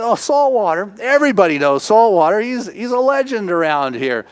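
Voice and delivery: high, enthusiastic retold gushing; gushing waitress. Waitress telling strangers about a local legend, high gushing.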